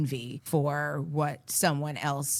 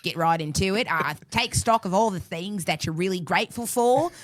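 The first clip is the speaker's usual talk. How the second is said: playful put-on Aussie patter, growing louder; Australian accent; 4 dB louder than usual. Telling listeners to take stock, mock Australian accent. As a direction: play a hearty Australian giving advice. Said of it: "without your Australian accent".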